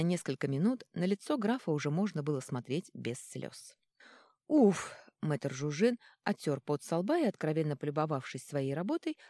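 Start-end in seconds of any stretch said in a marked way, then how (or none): 4.5-4.9 relieved exhale. Acted. Healer wipes sweat after work.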